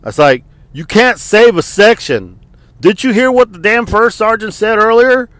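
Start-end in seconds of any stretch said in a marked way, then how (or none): none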